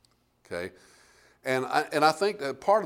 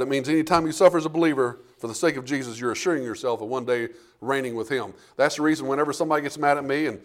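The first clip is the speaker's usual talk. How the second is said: normal talking voice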